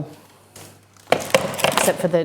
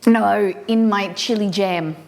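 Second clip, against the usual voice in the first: ordinary delivery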